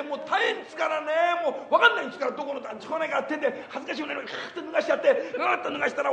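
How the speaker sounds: breathless hyper comedian routine with laughs; Sanpei impression. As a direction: rattle on like the frantic comic, shouting bits. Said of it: "三平の舞台を一つここでちょっとやってみましょう".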